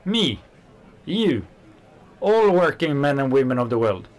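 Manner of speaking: high, ringing call to all workers; rallying cry. Acted. Worker rallying his doubting friend, fervent and loud. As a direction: rouse them like a crowd.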